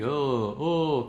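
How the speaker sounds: echoing, yawny "oh" from inside the mouth; hollow bolero-like "oh". Shown as a demonstration. Teacher showing bolero-like resonance, hollow "oh". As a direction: let "oh" resonate in an empty cavity. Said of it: "como si estuviéramos cantando boleros".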